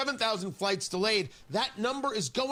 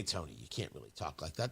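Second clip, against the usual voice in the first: imagined critic; 10 dB quieter than usual. Retold or imitated. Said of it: "Now, you say to me".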